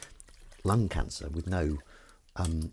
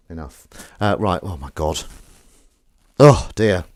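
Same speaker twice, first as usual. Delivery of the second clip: ordinary talk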